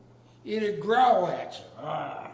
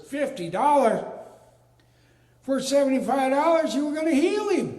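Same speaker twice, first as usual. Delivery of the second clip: much higher and louder outraged question; indignant disbelief; 8 semitones higher, 7 dB louder than usual. Retold or imitated. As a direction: protest the bill in shock. Indignant at the vet's bill.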